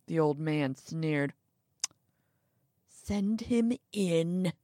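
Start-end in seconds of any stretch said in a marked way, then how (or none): 2.9-4.5 very slow, drawn-out weary order; sighing old man. A narrator's reading. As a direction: stretch each word with resignation. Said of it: "he said with a sigh".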